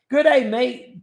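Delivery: hammed-up Australian greeting; Australian accent. Greeting a viewer from down under with a mock Australian accent. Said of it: "Got a mate from down under".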